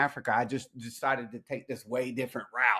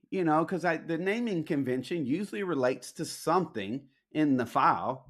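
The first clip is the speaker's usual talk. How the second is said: normal talking voice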